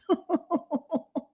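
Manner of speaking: very high, soft real giggle. Real, not acted. Host giggles at own story, very high giggle. Laughing at German lady story.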